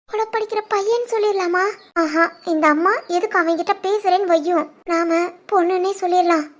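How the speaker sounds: soft, scheming aside; hushed private deliberation; inner thought. Acted. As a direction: work out an excuse under your breath. Daughter privately plots her excuse.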